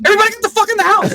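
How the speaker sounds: high, fast, loud shouted command; retold urgent yell. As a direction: imitate a squad leader yelling orders. Acting out leader yelling during raid.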